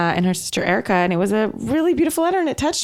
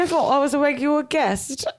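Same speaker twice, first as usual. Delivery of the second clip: higher mock protest; Max impression; 6 semitones higher than usual. Son told to work at party, high mock protest. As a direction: plead like a put-upon son. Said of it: "He's like".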